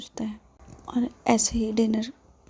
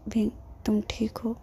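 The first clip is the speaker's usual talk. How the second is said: a worried whisper from the sickbed; concerned whisper; 4 dB quieter than usual. Acted. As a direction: ask it in a gentle, unvoiced whisper. Injured friend asks softly. (चिंता भरी फुसफुसाहट)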